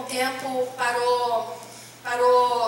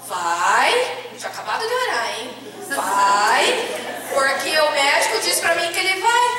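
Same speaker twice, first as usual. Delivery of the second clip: louder, firm insistence; louder insistent mother arguing with the nurse; insistent retort; 7 dB louder than usual. Retold or imitated. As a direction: push back hard, he's going to die. Insisting her son will die.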